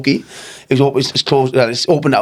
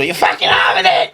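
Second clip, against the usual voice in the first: rough retold shout; strained, higher mock yell; mock furious threat; 4 semitones higher than usual. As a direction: imitate someone screaming for a fight. Mourner threatening him outside the club.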